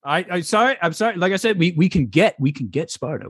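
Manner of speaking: high, hurried, scared guard. Acted. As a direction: grovel with a nervous high voice. Guard apologises to emperor.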